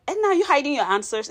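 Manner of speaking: mock accusation. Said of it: "So she's like".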